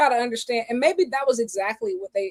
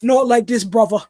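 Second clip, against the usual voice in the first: louder pleading line; dramatic plea; 8 dB louder than usual. Pretend. Mock wrestler begging a former partner, loud and pleading. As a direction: belt out the pained plea. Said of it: "impersonation of what Ospreay".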